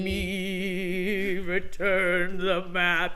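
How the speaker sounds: loud, hugely drawn-out command; hammed-up, slow, booming demand; booming movie-quote voice. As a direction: stretch each word out slowly and loudly. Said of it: "You have to say it like that".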